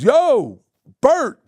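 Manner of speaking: high, loud, hyped "Yo, Bert"; [name] greeting. Retold or imitated. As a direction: call out across the room like a showman.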